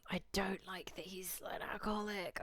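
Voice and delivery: breathy whisper, just for chat; whispered aside. Real, not acted. Streamer whispering an aside to chat. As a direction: lean in and whisper the complaint. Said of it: "whispered aside to chat".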